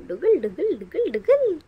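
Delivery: put-on bouncy baby-talk chant, swooping up and down; playful sing-song. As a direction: bounce the syllables to amuse a baby. Baby's head-wobble mimicked in play. (लाडिक, Khelkar लयीत)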